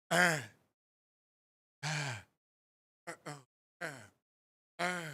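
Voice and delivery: lightly voiced wordless grunts; imitated deaf man. Comic retelling of deaf Bear staring at Barbara, imitated grunts. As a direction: mimic short, halting wordless noises. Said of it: "he's already deaf".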